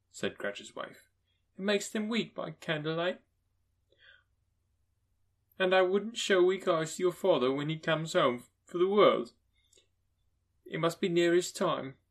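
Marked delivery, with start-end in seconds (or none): 1.6-12.0 higher and louder, holding back worry; a mother covering her fears; mother's voice. Acted. Mother to her children, bravely covering worry.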